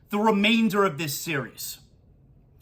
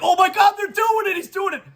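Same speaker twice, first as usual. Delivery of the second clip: high-pitched thrilled yelp rising to a shout; reliving the moment of disbelief, shouting at the TV; oh my God, they're doing it, squealed high; excited cheer; 10 semitones higher, 3 dB louder than usual. Retold or imitated. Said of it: "and you kind of like".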